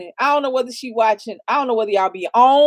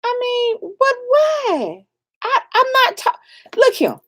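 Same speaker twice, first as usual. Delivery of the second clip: exasperated shout; 10 semitones higher than usual. Real, not acted. Exasperated outburst about panel.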